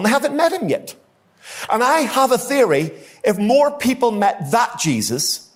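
impassioned pulpit peak; full-force preaching. Real, not acted. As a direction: lift the room toward the climax. Preacher rising to climax.

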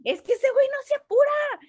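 high, loud hammed-up complaint; indignant mock shouting. Mock brawler explaining the fight over slow service, indignant shouting. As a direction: yell it like a hungry brawler, playing it for laughs.

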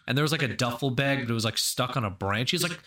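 ordinary talk, nothing marked

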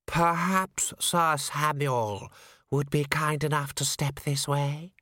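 slow, precisely articulated, icy courtesy; a voice from a tomb, every syllable placed; chilly, precise voice. A narrator's reading. Unseen herald invites the commander in, chilly and precise. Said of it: "It was chilly, clipping every syllable with precision".

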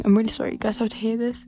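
normal talking voice

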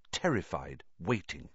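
plain reading voice

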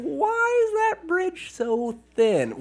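a high slow puzzled voice asking about the bridge; mock wonder. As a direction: ask it like a baffled viewer. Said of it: "You're wondering".

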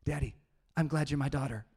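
lightly voiced four-year-old girl, earnest; a hint of a small child's sweet mix-up; imitating his little daughter. Dad imitates his daughter's bedtime reply, childlike. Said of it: "She grabs my face and gets my ear, and she says".

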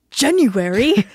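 January punched loud and high on an odd first syllable; exaggerated imitation of dad's "January"; mimicking dad's January. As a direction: hit JAN in January hard, in mock amazement. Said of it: "All of a sudden you were like".

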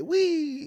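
high-pitched airy "wee"; playful wee. Retold or imitated. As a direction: sing out a drawn-out, high wee. Imitating a carefree shot thrown up.

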